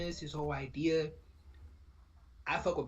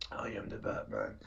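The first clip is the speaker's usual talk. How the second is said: low, slow, whispered Batman growl; 7 semitones lower, 3 dB quieter, slower than usual. Retold or imitated. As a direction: drop into a hushed, drawn-out Batman impression. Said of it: "to do the voice like that".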